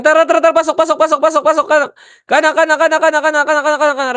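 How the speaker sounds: high, loud rapid-fire repeats; excited game shot-calling at full pitch; urgent rush call. Real, not acted. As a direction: drive the squad in with frantic high shouts.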